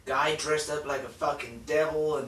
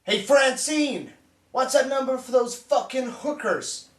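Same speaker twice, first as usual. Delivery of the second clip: raised-pitch shout for attention; calling out; 6 semitones higher than usual. Acted. Calling out to Francine.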